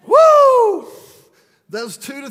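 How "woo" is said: high, loud whoop; shrill celebratory cheer. Real, not acted. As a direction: let out a big excited woo.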